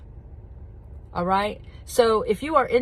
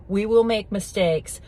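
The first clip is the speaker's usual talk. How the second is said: ordinary delivery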